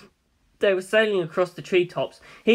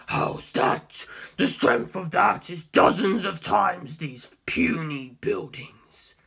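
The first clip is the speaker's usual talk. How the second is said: Cars villain voice; much slower than usual. Acted. Cars gloating at Dio over his pillar, slow villain voice. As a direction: gloat slowly over the rival's weakness. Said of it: "Cars speaks, held villain voice".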